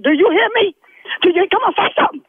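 angry demand. Real, not acted. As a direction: pitch jumps up as she demands an answer.

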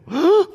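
sharp, high mock gasp; loud acted gasp of alarm; startled gasp. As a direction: gasp as if caught out. Acts scared child told God sees all.

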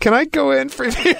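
tiny high sheepish plea, breaking into laughter; mock-meek request in a squeaky high pitch. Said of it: "I sheepishly called her up and said".

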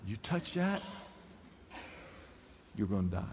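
very low, very soft dire warning; hushed warning. Shown as a demonstration. Pastor quietly voicing God's warning, low and hushed. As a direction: drop to a near-hush for the threat. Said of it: "now watch this".

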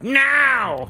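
high yelped now; high mock impression of Chris. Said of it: "Would you stop with my voice impressions?".

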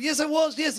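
high, over-eager confession; comic frantic yes; mock desperate eagerness. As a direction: blurt it out high, desperate to please. Desperately confirms role to attackers.

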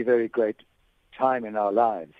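normal talking voice

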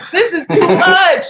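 high, loud, laughing through it; half-laughing, slowed protest; overwhelmed and laughing at the situation. Retold or imitated.

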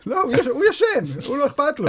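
high squeaky pet voice; cat voice. Pretend. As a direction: speak as the sleepy cat. Voicing the cat Boxy.

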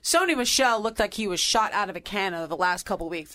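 normal talking voice

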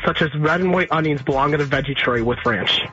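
usual speaking voice

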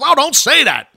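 high-pitched imitation of a flustered woman; mimicking Stephanie. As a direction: squeak it out like a scolding boss. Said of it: "She goes".

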